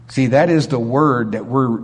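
ordinary talk, nothing marked

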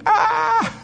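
high-pitched burst of mock laughter; Sarah's laugh. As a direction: act out an incredulous cackle at absurd news. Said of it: "what did he say to Sarah when she laughed?".

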